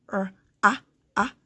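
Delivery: "r, a, a" as "rr, aah, aah"; r, then short "a" as in "cat", repeated; isolated sounds. Shown as a demonstration. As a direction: sound out r and a separately. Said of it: "vowel sounds do you hear".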